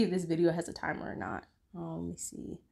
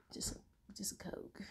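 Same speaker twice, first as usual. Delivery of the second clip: whisper; 3 semitones higher, 15 dB quieter than usual. Real, not acted. Aside about drink.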